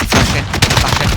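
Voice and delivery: sharp warning shout; loud callout. Real, not acted. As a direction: call it out to the team. Warning teammate, throwing flash.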